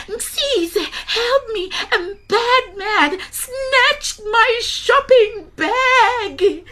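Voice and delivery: put-on very high, slow old woman pleading; crying old granny. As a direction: wail it like a desperate granny. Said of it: "cried Gogo".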